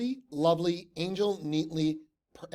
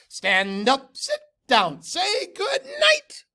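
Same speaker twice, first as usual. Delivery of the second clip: louder cheer, last word high and slow; 13 semitones higher, 8 dB louder, slower than usual. A narrator's reading. Horrified teammates carrying on, louder and rising high. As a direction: push through the cheer.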